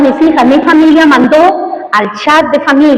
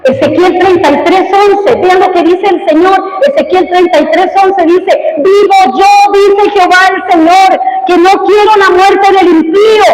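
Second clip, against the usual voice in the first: raised, driving pulpit voice; fiery preaching; 6 semitones higher than usual. Acted. Pastor urges his congregation to compassion, fiery preaching. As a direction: preach it hard and pushing.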